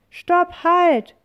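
very high, loud cry of stop; angry witch shout. A narrator's reading. Witch shouting after her lost wand, high and loud. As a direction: yell at them to stop as the witch. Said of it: "ruft die Hexe mit bösem Gesicht".

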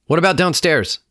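high, loud follow-up question; soldier's demand. A narrator's reading. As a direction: press them for an answer. Soldier interrogating the family.

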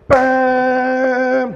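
loud, high punchy bam; mock crash sound. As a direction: slam the word like a collision. Sound of braking, acted crash.

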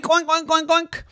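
high squeaky oinks; high piggy oink oink. Retold or imitated. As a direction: oink like a little pig. Pig noises instead of words.

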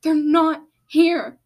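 slow, loud, high wail; crying. A narrator's reading. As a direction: drag it out as a distressed cry. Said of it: "She started crying".

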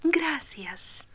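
soft whispered thanks; sweetly. Acted. Bambi's mother thanks the visitor, sweet and whispered. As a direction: breathe it gently, tender mother. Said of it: "dijo dulcemente la madre".

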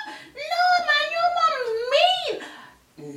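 very high, slow squeal of disbelief; drawn-out, squeaky outrage; mock shocked schoolmate. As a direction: imitate a classmate aghast.

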